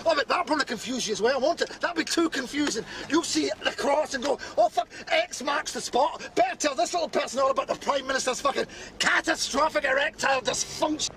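high-pitched, sarcastic tirade; worked-up, mocking outburst; scornful rant. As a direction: rant with mounting exasperation.

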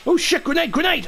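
sudden high shout of alarm; grenade warning yell. Real, not acted. Grenade lands near squad.

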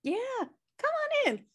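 chirpy hostess greeting, pitched high; cheery welcome. Acted. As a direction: sing the invite way up high. Cheerful welcome to pizza guest.

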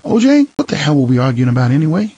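catching her breath after a fight; wife mid-fight. Acted. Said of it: "pausing to catch her breath and saying".

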